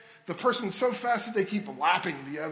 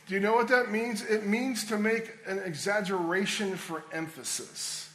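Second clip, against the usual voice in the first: ordinary delivery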